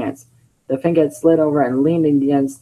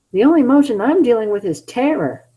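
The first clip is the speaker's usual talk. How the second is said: mild scared mumble; 9 semitones higher than usual. Acted. Sonic mumbling fear.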